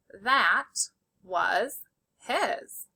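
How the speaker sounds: very slow, word by word; slow dictation. Shown as a demonstration. Teacher dictating to students, very slow. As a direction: stretch out That was his. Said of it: "Say it with me".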